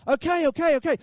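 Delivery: high, hurried placating; mock-flustered parent relenting; acted giving in. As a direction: hush clamouring kids by agreeing. Parent giving in to clamouring kids.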